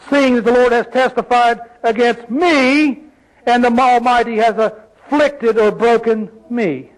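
each "me" held long and set apart; stress on me. Shown as a demonstration. Preacher reading out her complaint, pointed emphasis on me. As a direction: lean on every "me" as you read. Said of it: "put emphasis on the words as I read it".

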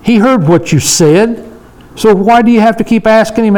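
usual speaking voice

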